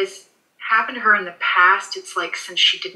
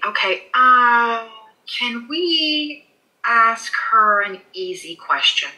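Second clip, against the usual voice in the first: fighting back tears as she asks; choked up; 3 semitones higher, slower than usual. Real, not acted. Medium to owner, slow with a catch in her voice. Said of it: "choking up".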